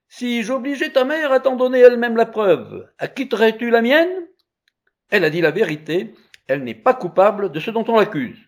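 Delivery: put-on high, loud child's plea; insistent young challenger; bold child challenger. A narrator's reading.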